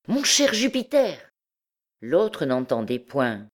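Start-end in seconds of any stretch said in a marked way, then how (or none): 0.1-1.3 high, coaxing hail; insistent calling. A narrator's reading. Stranger trying to rouse the dazed actor, insistent call. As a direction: call him insistently by name. Newcomer calls again, insistently.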